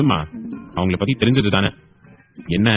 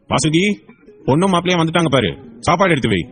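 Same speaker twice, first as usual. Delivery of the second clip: raised, higher-pitched call; 6 semitones higher than usual. Acted. Father calls his wife to serve food, calling out. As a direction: call through the house to your wife.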